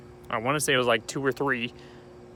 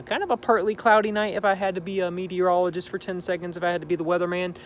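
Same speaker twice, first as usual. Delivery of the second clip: higher, fast mock southern weatherman twang; 6 semitones higher than usual. Vlogger hamming up a southern weatherman accent on a warm night. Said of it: "I don't know why I got an accent all of a sudden".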